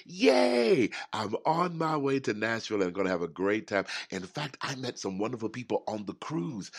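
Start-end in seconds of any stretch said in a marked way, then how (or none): 0.1-0.9 high, loud whoop of joy; excited cheer. Real, not acted. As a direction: cheer like you can't wait to get there. Excited about trip to Nashville.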